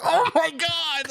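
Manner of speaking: high, drawn-out shocked cry; shocked gasp. Retold or imitated. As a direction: squeal it like a horrified mom. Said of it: "she was like".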